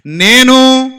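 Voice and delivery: loud single word to repeat; said loudly. Shown as a demonstration. Preacher shows the loud start of the verse. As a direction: punch out the first word loudly. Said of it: "గట్టిగా చెప్పాలి".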